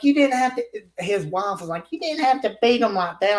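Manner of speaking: a lightly voiced wife; mock complaining wife. The wife protesting how hard the narrator hit.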